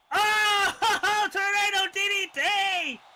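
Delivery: big hype call; excited shout. Real, not acted. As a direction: shout the move with a laugh. Calling a springboard move.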